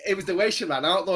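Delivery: usual speaking voice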